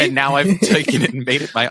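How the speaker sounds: ordinary delivery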